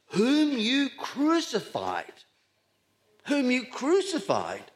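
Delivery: high, slow, weighty stress on "crucified"; drawn-out, high-pitched accusing emphasis on "whom you crucified"; emphatic accusation. Acted. Preacher hammers home Peter's accusation.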